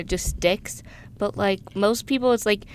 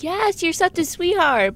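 high, drawn-out gush; 8 semitones higher, slower than usual. Acted. Girl answering an emotional date invite, gushing. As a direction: melt at a sweet confession. Delighted answer to sincere asking.

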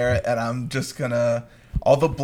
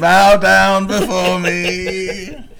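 mock grandiose; 4 semitones higher, 5 dB louder, slower than usual.

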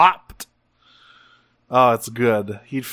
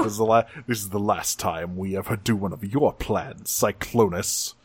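grumbling Megatron; 4 semitones lower, 6 dB quieter than usual. Retold or imitated. Megatron blames Cyclonus, low grumble. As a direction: grumble it down at him.

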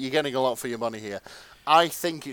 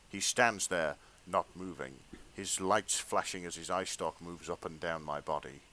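low, measured story-reading voice; hushed erotica narrator; fanfic narration; 8 semitones lower, 6 dB quieter than usual. Acted. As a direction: read it like a sensual audiobook, played straight.

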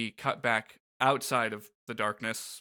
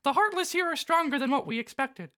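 Mickey Mouse voice; 13 semitones higher than usual. Acted. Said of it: "Mickey continues".

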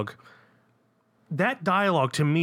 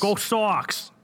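higher, put-on Boston cheer; bad Boston accent; 7 semitones higher than usual. Cohost repeats his bad Boston accent catchphrase. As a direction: toss off a fake Boston "Go Sox". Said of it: "that part must have been on repeat".

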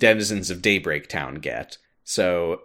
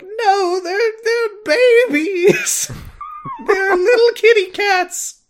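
very high, loud, drawn-out mock wail; wailing little child; 6 dB louder, slower than usual. Child of the group defends the creatures, mock-tearful wail. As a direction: plead in a squeaky, hammed-up crying child voice.

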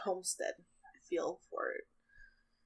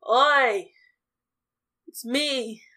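loud gruff yell, higher; hammed-up impatient yell, slow on the last words; shouting at the door; 4 semitones higher, 13 dB louder, slower than usual. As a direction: bang on the door and bellow to be let in. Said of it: "And goes".

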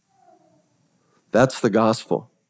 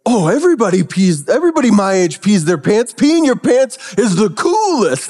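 Billy's proud boast; 8 semitones higher than usual. Retold or imitated. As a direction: belt it out like it's the coolest thing. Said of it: "And then he goes".

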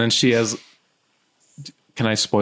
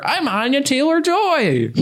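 silly high voice. Pretend. Silly voice naming actress.

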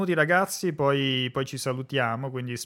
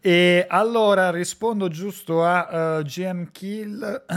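hoarse, slow, straining to speak; 5 semitones higher, 8 dB louder, slower than usual. Real, not acted. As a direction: voice suddenly giving out. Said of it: "mi è andata via la voce improvvisamente".

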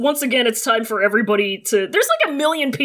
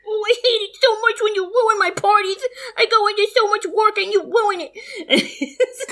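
much higher, whining about parties; whiny brat; 10 semitones higher than usual. Acted. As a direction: whine like a spoiled kid. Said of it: "And he's just".

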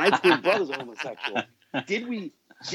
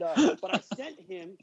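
ordinary delivery

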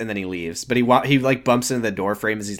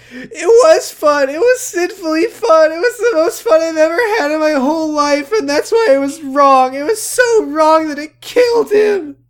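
big cry voice; 6 dB louder than usual. Retold or imitated. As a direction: wail it like hammy fake crying. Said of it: "I'm gonna do it in her big cry voice".

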